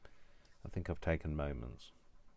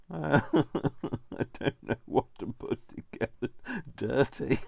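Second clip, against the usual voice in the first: high, slow words through giggles; real laughing fit, voice pitched up; talking through laughter; 8 semitones higher, 4 dB louder, slower than usual. Real, not acted. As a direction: struggle to speak while laughing. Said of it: "It's not even that funny".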